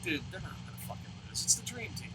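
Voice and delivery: unvoiced, hushed self-talk; hushed, sure the team will win; whispered aside. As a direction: whisper it to yourself. His inner reassurance.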